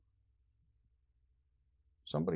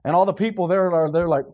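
ordinary talk

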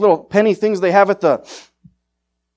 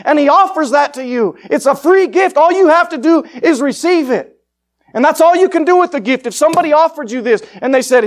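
rousing gospel preaching; 7 semitones higher, 5 dB louder than usual. Acted. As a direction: lift it up and offer the gift.

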